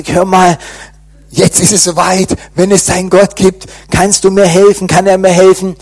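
calling his friend the nurse in desperation, urgent and loud; urgent plea. Retold or imitated.

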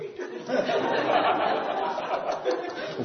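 airy high laugh, falling away; high breathless laugh. Real, not acted. Preacher recalling the smiling congregation, airy laugh. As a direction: let a breathy giggle escape.

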